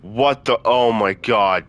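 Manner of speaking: sudden jump scare, voice leaps up loud; startled. Real, not acted. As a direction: blurt it out, really spooked. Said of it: "dude, that freaked me out".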